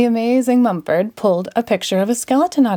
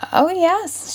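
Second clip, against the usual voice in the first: much higher, delighted; girl's voice; 11 semitones higher than usual. A narrator's reading. Little girl feeling her bone, high and pleased.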